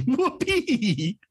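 very high, drawn-out cartoon cheer; Garfield. Podcaster quotes a cartoon cat's line, high gleeful squeal. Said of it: "but Garfield is saying".

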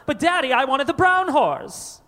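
high whiny begging brat; spoiled rich kid. Acted. A spoiled kid begging Daddy, high and whiny. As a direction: whine to Daddy for the horse. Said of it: "that's my rich kid voice".

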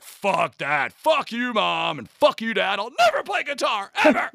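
hammed-up rebellious son; mock teen rebellion. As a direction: play the defiant teenager swearing off guitar. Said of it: "And I was like".

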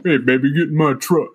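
a hint of a flirty guy; lightly voiced smooth-talker; smooth dude voice. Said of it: "And I said".

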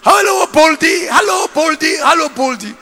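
loud, high repeated taunt; shouted playground-style teasing; mocking kids. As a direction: chant it like jeering children. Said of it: "mock him and say".

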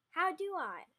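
exasperated complaint; annoyed. Acted. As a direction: object with irritation, starting loud. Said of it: "Hunter said, annoyed".

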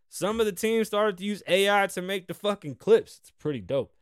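ordinary talk

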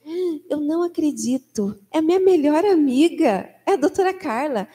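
imitated astonished doctor, building; retold delighted amazement. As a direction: act out a doctor's happy disbelief. Doctor learns pediatrician is best friend.

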